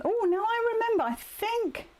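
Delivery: high, excited "oh now I remember"; light up as it comes back to you; sudden realization. Real, not acted. Suddenly remembers goodie bag.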